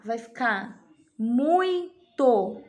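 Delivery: muito drawn out very slowly; slow, deliberate teacher voice; slow dictation. Shown as a demonstration. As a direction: spell out muito for the children. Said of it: "teacher dictating answer slowly".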